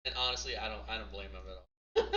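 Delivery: usual speaking voice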